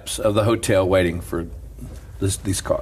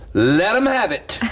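laughing quote; 11 semitones higher than usual. Retold or imitated. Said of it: "She goes".

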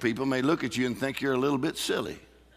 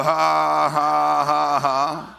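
loud long ha-ha-ha; defiant demonstrated laugh; 5 dB louder, much slower than usual. Shown as a demonstration. Answering the devil about a raise at work, forced laugh. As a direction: force a big, steady laugh. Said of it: "You go".

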